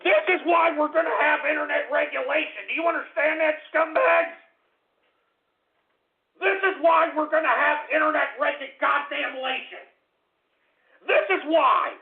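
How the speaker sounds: heated tirade; angry rant. Acted. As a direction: lecture the trolls at the top of your voice. Ranting at internet trolls.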